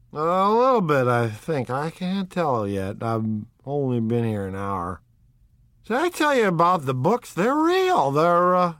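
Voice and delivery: put-on high, loud, eager drunk know-it-all; a stout drunk bursting to share his discovery; tipsy owl-eyed man. A narrator's reading. Drunk guest tells Jordan the books are real, excited.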